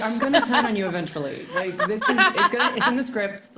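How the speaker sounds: laughing high, building to loud. Retold or imitated. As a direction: laugh through it, rising up. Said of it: "I was like".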